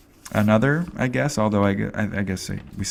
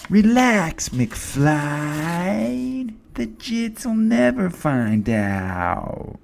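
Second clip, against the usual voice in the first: movie bully voice; 8 semitones higher, much slower than usual. Retold or imitated. Quoting Back to the Future bully.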